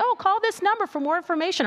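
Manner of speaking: high, fast mock radio ad read. Mimicking a podcast ad for the crowd, high and chirpy. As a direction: chirp it like a cheesy ad. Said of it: "you hear and say".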